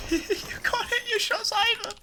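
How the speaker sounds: mock him in a squeaky voice; high mocking squeal. Real, not acted.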